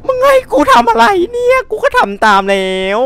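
very high, loud panicked wail; terrified scream. Real, not acted. Streamer screaming and wailing at the game after being caught, terrified.

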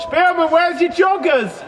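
loud teasing call from afar. Real, not acted. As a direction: heckle him across the pitch. Teasing coach from distance.

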